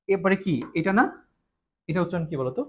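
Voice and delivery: ordinary talk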